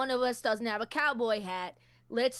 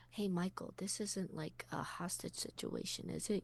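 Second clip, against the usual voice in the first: hushed whisper aside; 3 semitones lower, 13 dB quieter than usual. Real, not acted. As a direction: whisper so Venom can't hear. Aside to Michael, secret.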